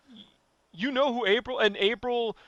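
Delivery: ordinary talk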